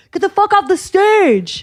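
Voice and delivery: high, drawn-out heckler's yell; retold furious yell at a performer; imitating aggressive heckler. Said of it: "Like, really aggressive".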